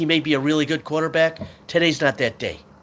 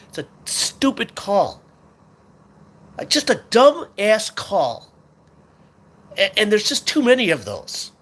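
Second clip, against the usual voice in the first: slow, louder scornful complaint; angry; 6 semitones higher, 4 dB louder than usual. Real, not acted. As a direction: spit out the disgust at the call. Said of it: "but I'm pissed".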